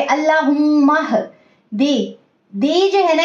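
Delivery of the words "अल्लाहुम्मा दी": अल्लाहुम्मा दी with the हा joined onto the म; joined recitation. Shown as a demonstration. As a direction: glide the म straight into हा. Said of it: "हा को मीम से मिला के".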